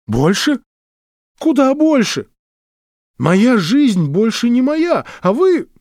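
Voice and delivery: high, strained, close to panic; a man whose life is slipping away; distraught outburst. Acted. Bezil breaks in, distraught.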